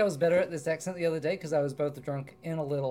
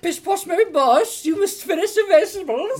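high prim scolding; posh nanny voice; 13 semitones higher, 10 dB louder than usual. Acted. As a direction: tut at a naughty child.

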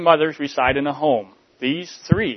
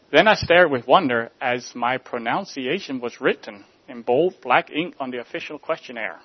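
ordinary talk

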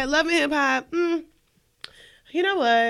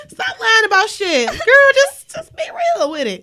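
high laughing shout; laughing rant; 11 semitones higher, 8 dB louder than usual. Real, not acted. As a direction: yell it through laughter. Ranting at Yandy, laughing.

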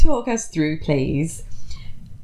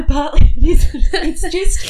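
laughing; 9 semitones higher, 6 dB louder than usual. Real, not acted. Laughing mid-sentence.

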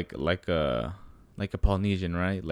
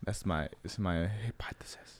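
mock whisper; 8 dB quieter than usual. Jokey whispered aside.

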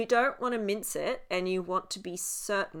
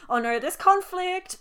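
mock alarm; 12 semitones higher, 8 dB louder than usual. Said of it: "it makes me very".

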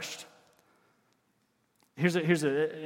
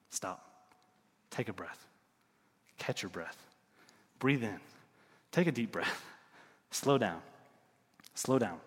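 soft, low, with long pauses; hushed calming appeal; 5 semitones lower, 7 dB quieter than usual. Real, not acted. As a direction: settle the listeners down gently. Preacher urges listeners to rest.

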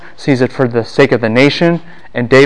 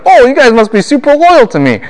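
high, animated mock voice of a flattered king; gullible David; 11 semitones higher, 3 dB louder than usual. Teacher mimicking David taken in by false loyalty, high mock credulity. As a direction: lift the pitch high, a hammed-up easy believer. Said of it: "And David's like".

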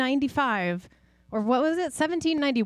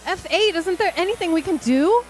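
high, loud panicked pleading; panicked shout; 7 semitones higher, 5 dB louder than usual. Acted. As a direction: beg the robot in alarm. Host panics as engine shuts.

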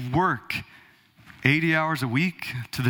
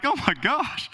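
higher mock groan of dismay; 7 semitones higher than usual. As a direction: play the overwhelmed congregant. Listeners groaning at twelve chapters.

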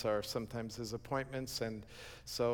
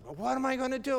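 much higher, helpless outburst; exasperated dismay; 12 semitones higher, 3 dB louder, faster than usual. Retold or imitated. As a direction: throw up your hands at the bad news. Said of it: "I just like".

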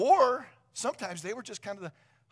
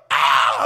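high, loud startled scream; kid's panicked yelp; 9 semitones higher, 19 dB louder than usual. Retold or imitated. Bitten boy yelps at the fair, panicked scream. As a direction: yelp as the sheep bites. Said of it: "I'm jumping back and I'm crying".

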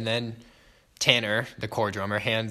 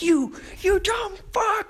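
high, slow, enraged shriek at a bandmate; retold furious yell; slower than usual. Drummer freaking out at Andrew over lost charts, screaming. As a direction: scream the insult like you've lost it. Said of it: "he yells at him".

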